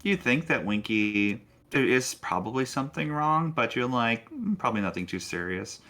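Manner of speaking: ordinary talk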